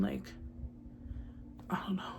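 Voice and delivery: normal talking voice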